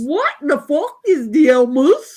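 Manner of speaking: loud, hammed-up Carolinian accent; thick Carolinian accent. Repeating the homeless man's question about Helmut, thick Carolinian accent. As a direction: belt it out like a baffled Carolinian. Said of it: "in the thickest Carolinian accent".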